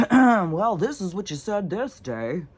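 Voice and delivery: hammed-up courtroom gotcha; mock lawyer pouncing. Mocking lawyers quizzing a witness.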